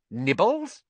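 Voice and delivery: high, sugary offer; lilting, too-sweet question; mock sweet. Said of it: "he said sweetly".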